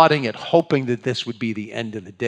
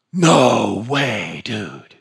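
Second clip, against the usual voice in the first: mock dumbfounded "no way, dude"; slack-jawed surfer awe; stunned disbelief; 3 semitones lower, 4 dB louder, much slower than usual. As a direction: play the gobsmacked disciple. Disciples gaping after the calm.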